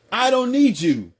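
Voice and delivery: high, drawn-out mock rejection; acted kiss-off, slow and high; emphatic dismissal. Acted man dismissing his wife.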